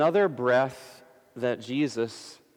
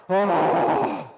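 long, loud exhaled groan; loud groaning sigh; 4 semitones higher, 6 dB louder than usual. Shown as a demonstration. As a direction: let out a last heavy sigh. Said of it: "something like a loud groan or sigh".

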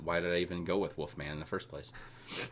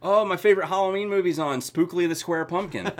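excited; 9 semitones higher, 6 dB louder than usual. Real, not acted. Mock excitement over a TV movie.

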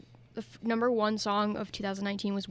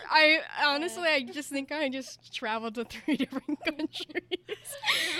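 laughing; 5 semitones higher, 3 dB louder than usual. Real, not acted. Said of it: "Australian and then British".

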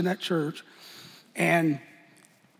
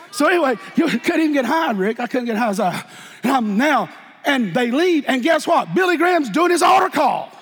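higher and louder, building preacher's cry; fiery preaching; 6 semitones higher, 5 dB louder than usual. Acted. Preacher building his story to the altar call. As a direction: drive it home to the church.

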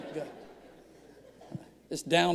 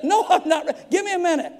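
flustered; 9 semitones higher, faster than usual. Retold or imitated. Pastor retells being unready to record.

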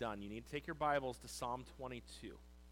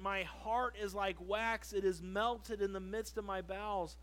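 solemn scripture reading; 4 semitones higher, 4 dB louder than usual. Acted. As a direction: let every phrase land like a proclamation. Preacher reads psalm aloud.

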